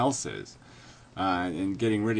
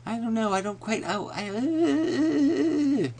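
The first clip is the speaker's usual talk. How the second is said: very high, dithering mock-meek voice; 11 semitones higher than usual. Mimicking someone unsure making a suggestion, meek. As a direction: squeak out helpless hedging, stretching the last sound. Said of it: "make little meek suggestions".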